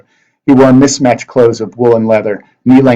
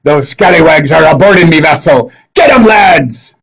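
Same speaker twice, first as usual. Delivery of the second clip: pirate captain Blackbeard; 6 semitones higher, 5 dB louder than usual. Acted. Blackbeard urging his lads to attack the sorceress, loud pirate shout. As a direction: bark like a swaggering pirate captain.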